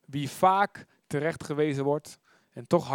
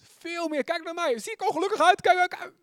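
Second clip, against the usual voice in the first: much higher, louder, teasing kijk naar mij; playful friend's boast; 4 dB louder than usual. Retold or imitated. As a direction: show off a happy face. Preacher voices a friend in the story. (uitdagend en vrolijk hoog)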